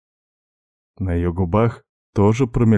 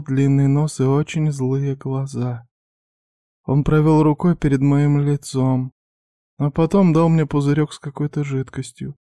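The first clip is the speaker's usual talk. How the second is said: put-on higher, timid young woman; young girl voice; 7 semitones higher than usual. A narrator's reading. Girl's voice again.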